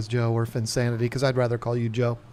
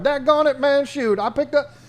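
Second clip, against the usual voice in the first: Boomhauer impression; 12 semitones higher than usual. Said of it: "he sounds like Boomhauer".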